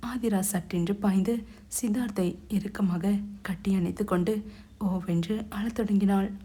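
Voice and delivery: ordinary delivery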